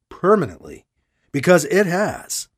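ordinary delivery

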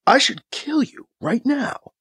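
ordinary delivery

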